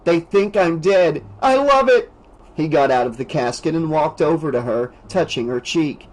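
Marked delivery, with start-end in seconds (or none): 0.0-2.1 gleeful. Acted. Rick gleeful at own funeral.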